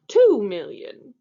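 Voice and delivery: archduke raising his offer. Acted. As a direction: lean on it like a stubborn buyer. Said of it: "he said".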